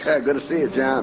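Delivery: put-on a warm, laughing hello to an old friend; chuckling greeting to an old friend. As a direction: laugh it out, glad to see him. Old friend greets him warmly.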